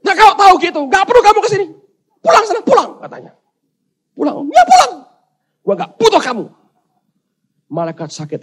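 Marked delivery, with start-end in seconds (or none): 0.0-2.9 high, loud shouted send-off; angry dismissal. Acted. Man sends angel away. 4.1-6.4 high, loud shouted send-off; angry dismissal. Acted. Man sends angel away.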